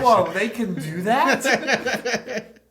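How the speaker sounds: amazed. Acted. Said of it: "I'll just say".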